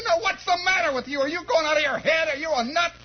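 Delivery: high-pitched mock scolding; agitated mother voice, pitched high. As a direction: play an exasperated mom telling off her kid. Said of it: "and my mother said".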